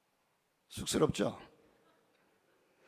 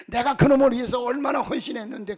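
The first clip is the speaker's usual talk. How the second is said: a louder, higher, aggrieved parent; indignant parent; 5 semitones higher, 6 dB louder than usual. Retold or imitated. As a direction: protest hurt sacrifice. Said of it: "부모들에게 물어보면 펄쩍펄쩍 뜁니다". (억울해하며 항변하는 부모)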